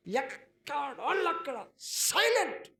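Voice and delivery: high, loud and slow; emphatic proclamation. Acted. Pastor preaching to crowd, high slow proclamation. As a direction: hammer it home slowly.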